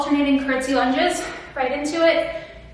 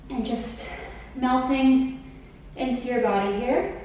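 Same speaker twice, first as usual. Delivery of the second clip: calm cool-down guidance; 6 dB quieter than usual. Acted. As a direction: ease them into the stretch. Leads the cool-down stretch.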